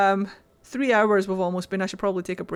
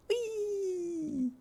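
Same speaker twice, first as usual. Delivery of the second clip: squeal; 11 semitones higher, 11 dB quieter than usual. Real, not acted. Playful squeal.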